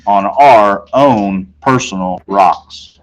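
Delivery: ordinary delivery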